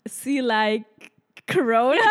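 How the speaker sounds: loud, rising panicked spelling; imitating herself on the phone with a client; mock flustered self. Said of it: "Und ich nur so".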